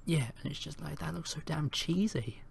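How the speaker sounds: soft, breathy dismissive whisper; whispering scorn about a cheesy trailer; scornful whisper. Real, not acted. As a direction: hush it like sharing a sneer. Mocking a bad trailer.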